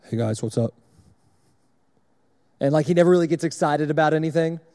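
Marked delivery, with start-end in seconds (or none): 0.0-0.7 lower, deep monotone impression of a friend; monotone Kason. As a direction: college friend greets the guys in a deep monotone. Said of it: "He's got this kinda monotone voice".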